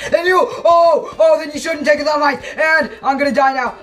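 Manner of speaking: high, rapid-fire cries of alarm; panicked babbling. Real, not acted. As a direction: babble in panic as death closes in. Attacked mid-ramble, fears dying.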